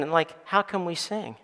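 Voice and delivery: ordinary delivery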